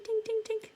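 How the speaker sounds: very high tinkling dings; jingling sound. Shown as a demonstration. Teacher showing what jingle means, high dinging. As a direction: imitate little bells, high and light. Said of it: "That's kind of like a ding".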